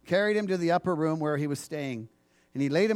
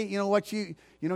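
usual speaking voice

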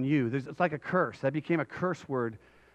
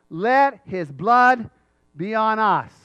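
loud, raised, slow declaration; crowd's proclamation; 4 semitones higher, 7 dB louder, much slower than usual. Acted. All the people answering Pilate, loud defiant proclamation.

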